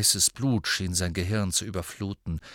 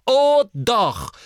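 "Oh doch" shouted much higher and louder; angry retort; 12 semitones higher, 10 dB louder than usual. Acted. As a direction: fire back at her in fury. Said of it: "erwiderte Ron genauso zornig".

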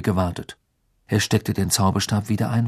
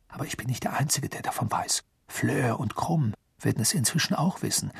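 hushed voice; 2 semitones higher, 6 dB quieter than usual. Acted. Harry continues secret explanation.

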